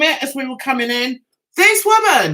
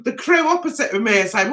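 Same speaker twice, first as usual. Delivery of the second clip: usual speaking voice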